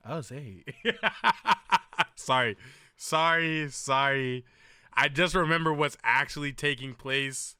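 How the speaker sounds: ordinary talk, nothing marked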